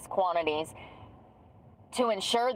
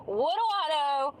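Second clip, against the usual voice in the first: mock innocence; 8 semitones higher than usual. Repeated mock question.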